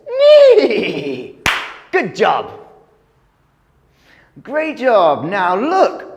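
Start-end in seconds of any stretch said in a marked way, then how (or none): 0.1-1.4 horse neigh. Shown as a demonstration. Teacher voices horse neigh.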